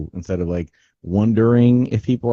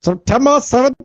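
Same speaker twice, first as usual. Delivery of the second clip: put-on old newsreel; 4 dB louder than usual. Joining the newsreel bit.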